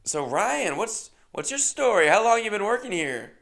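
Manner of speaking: mic in his face, bubbly; host interview. Retold or imitated. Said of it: "and she goes".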